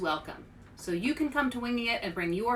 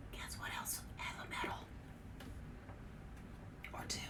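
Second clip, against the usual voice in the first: hushed aside to the audience; whisper; 17 dB quieter, faster than usual. Real, not acted. Reader whispering a teasing aside to the kids. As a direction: lean in and whisper the secret. Aside to viewers about medals.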